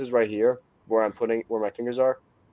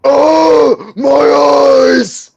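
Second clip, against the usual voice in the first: high, loud pained scream; screaming my eyes; 16 dB louder, much slower than usual. Retold or imitated. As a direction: shriek as if blinded. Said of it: "I saw that on a YouTube video".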